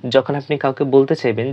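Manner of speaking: ordinary talk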